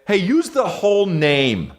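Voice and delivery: high, put-on scolding voice; mock indignant correction. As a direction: snap at them to say it right. Scolding imagined fan.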